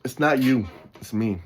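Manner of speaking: ordinary talk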